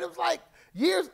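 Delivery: ordinary talk, nothing marked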